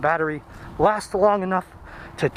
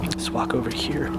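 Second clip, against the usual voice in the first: hushed whisper in a creepy spot; 6 semitones lower, 5 dB quieter than usual. Real, not acted. Creeping through a spooky pond trail, whispering.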